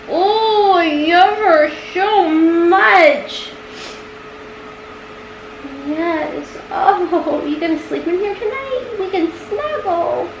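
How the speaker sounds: high sing-song pet voice; cooing to the cat to come back. Real, not acted. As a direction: coo up high at the kitty.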